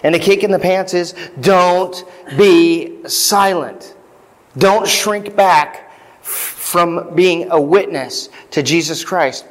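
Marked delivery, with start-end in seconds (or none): 1.4-9.5 every word drawn out, a firm command; slow stern charge. Acted. God urging Paul not to shrink back, slow and firm. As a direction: lay down the charge slowly, like God prodding Paul. God's kick in the pants to Paul.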